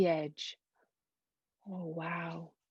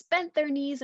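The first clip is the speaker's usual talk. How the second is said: plain reading voice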